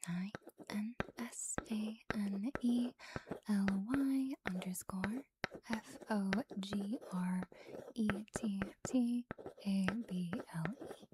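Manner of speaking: slow, hushed letter-by-letter spelling; drawn-out, whispery spelling for relaxation; ASMR name trace. Acted. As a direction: spell it softly, letting each letter linger.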